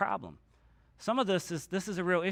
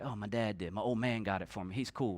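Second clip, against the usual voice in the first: put-on lowered casual teen; 9 semitones lower, 8 dB quieter than usual. Son bragging about dad.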